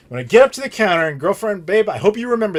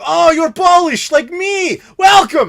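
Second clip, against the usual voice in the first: much higher, louder, slow hammed-up Russian accent; 12 semitones higher, 7 dB louder, slower than usual. Polish hotel man welcoming a fellow Pole, hammed-up Russian accent. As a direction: ham a cheerful Russian accent. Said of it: "why are you doing a Russian accent".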